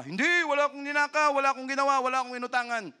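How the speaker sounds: high, loud indignant denial. Retold or imitated. Lying child denies the theft, high loud protest. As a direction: protest innocence at the top of the voice.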